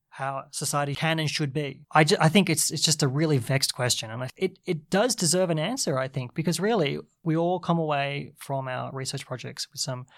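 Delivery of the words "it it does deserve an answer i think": normal talking voice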